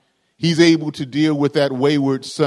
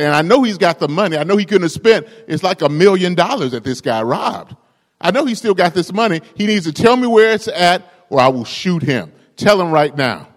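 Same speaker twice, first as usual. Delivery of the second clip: higher, louder, fast threatening demand; angry ranger threat; 5 semitones higher, 4 dB louder than usual. Acted. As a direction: threaten him to his face.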